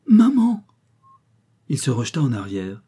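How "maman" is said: much higher, louder cry of Maman; child's cry. A narrator's reading. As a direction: call out to his mother with arms outstretched. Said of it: "cria Jean". (l'enfant crie Maman à sa mère, très fort et aigu)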